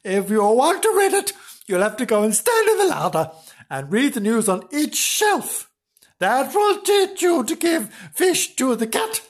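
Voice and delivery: high-pitched, insistent telling-off; hammed-up triumphant nagging; scolding wife. Wife lays down punishment.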